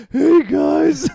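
high, loud, goofy mock greeting; Jesus impression. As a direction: squeak it out as a silly impression. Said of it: "that was a great Jesus impression".

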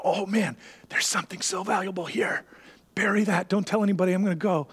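hushed excitement over a secret find; joyful discovery. Acted. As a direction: keep the thrill under your breath. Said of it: "In his joy".

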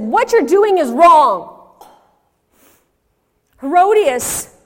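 high, slow, booming rebuke; shouted accusation. Acted. John confronts Herod in the throne room, shouted accusation. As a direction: thunder the charge at the ruler. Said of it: "he pointed at Herod and he said".

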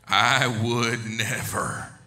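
very slow, low, drawn-out declaration; solemn mock vow. Preacher mocking absolute words, slow solemn vow. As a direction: stretch out each word with weighty certainty.

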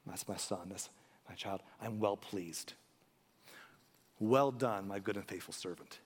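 softly voicing the Heavenly Father's praise; tender voice of God. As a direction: speak as a proud father blessing his son, very gently. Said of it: "hear my Heavenly Father say".

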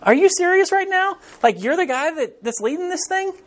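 high, disbelieving question; mock incredulous pitch. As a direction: ask it in flat disbelief. Incredulous opening quote.

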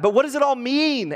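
high, loud, bewildered question; storybook girl asking urgently; Susan questioning. A narrator's reading. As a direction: lift the voice right up for a child's question. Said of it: "asked Susan".

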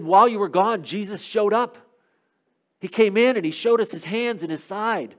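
voicing excited disciples; excited report. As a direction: report it with pitch lifted, like breaking news.

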